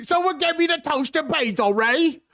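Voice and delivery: high, hammed-up British order for breakfast; mock British accent. Mocking British listeners, demanding breakfast in a hammed-up British accent. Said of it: "make fun of Australian accents".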